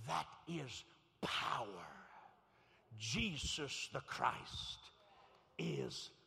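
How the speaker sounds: very slow, loud declaration; drawn-out pulpit proclamation; emphatic proclamation. Real, not acted. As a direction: hammer out each word with weight. Preacher proclaims creed.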